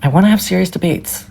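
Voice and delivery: ordinary delivery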